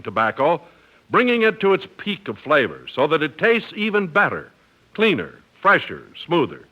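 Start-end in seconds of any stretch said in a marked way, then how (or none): none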